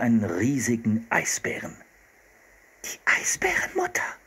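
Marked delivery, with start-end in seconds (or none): 2.8-4.1 put-on breathy awed whisper from a girl; whispered with no voice at all. A narrator's reading. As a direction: hush it, the bear is near. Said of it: "flüsterte Anne".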